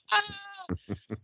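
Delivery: high burst of laughter. Real, not acted. Friend cracks up at the slip, high laughter.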